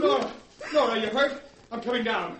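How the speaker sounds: high anxious call; worried calling. Acted. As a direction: call down to her in alarm. Wife nearly hurt.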